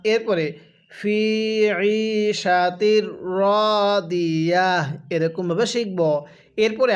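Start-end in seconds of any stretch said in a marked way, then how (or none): 0.9-4.9 very slow, drawn-out tilawat; slow recitation. Shown as a demonstration. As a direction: chant it slowly for students to repeat. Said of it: "আরেকবার পড়বেন আমার সাথে". (ধীর তিলাওয়াত)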